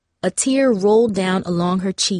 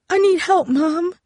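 high, crying plea; tearful plea; 11 semitones higher than usual. A narrator's reading. Darcy pleading with Mom for help, tearful and high. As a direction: beg for help through tears. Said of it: "tears flowing freely from her eyes".

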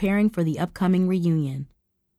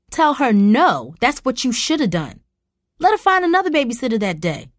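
angry demand; 6 semitones higher, 5 dB louder than usual. Acted. Mother berates daughter.

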